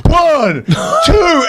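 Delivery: mock count. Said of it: "starts going".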